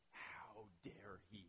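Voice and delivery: slow, indignant whisper. Acted. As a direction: hiss the outrage under the breath, slowly. Furious brother's inner reaction.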